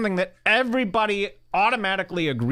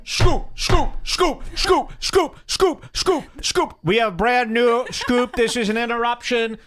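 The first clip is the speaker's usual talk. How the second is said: mock breaking-news bulletin; 7 semitones higher than usual. Host interrupting with a scoop, high mock newsflash. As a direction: announce it like an urgent scoop, pitched way up.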